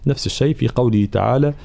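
usual speaking voice

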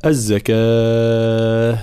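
"الزكاة" with the final aa held very long; long madd at stop. Shown as a demonstration. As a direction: stretch the last vowel of "الزكاة" on a level note. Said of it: "وقفنا على كلمة الزكاة".

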